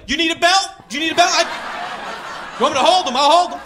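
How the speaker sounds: high, loud mock eager dad; eager helpful dad. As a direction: offer the belt like a pushy helpful dad. Another dad offering to help spank.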